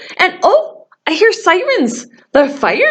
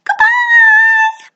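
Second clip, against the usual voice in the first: much higher, louder squeaky goodbye; 3 dB louder than usual. Shown as a demonstration. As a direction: squeak it out as high as you can. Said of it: "goodbye as high as we can".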